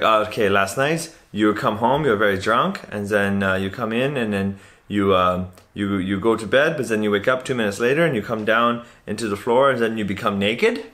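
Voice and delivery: put-on hesitant, accented roommate recounting the night; foreign roommate impression. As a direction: imitate an uneasy foreign bunkmate. Plays foreign hostel roommate.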